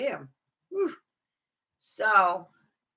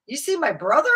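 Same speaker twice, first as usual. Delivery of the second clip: ordinary talk, nothing marked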